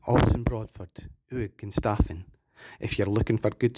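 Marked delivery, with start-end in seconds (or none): none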